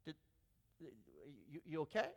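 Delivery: ordinary talk, nothing marked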